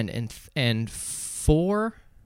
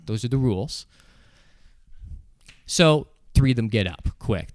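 ordinary talk

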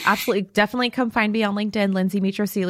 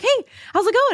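cheery greeting; 13 semitones higher than usual. Retold or imitated. Greeting the stranger, she plays herself high and cheery. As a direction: sing it up like a friendly salesperson. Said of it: "I'm like".

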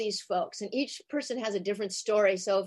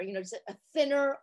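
ordinary talk, nothing marked

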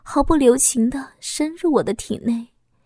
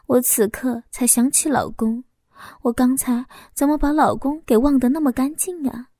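usual speaking voice

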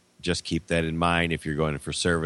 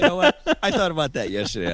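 laughing; 8 semitones higher, faster than usual. Real, not acted. Laughs while telling.

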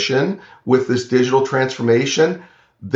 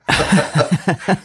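short, high nervous chuckle; nervous laugh; 7 semitones higher than usual. Real, not acted. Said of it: "I'm laughing, but it's a nervous laugh".

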